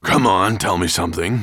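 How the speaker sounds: loud, growling demand; growled. A narrator's reading. Disgruntled dragon demanding a story, growling loudly. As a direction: growl impatiently at the servant. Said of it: "he growled".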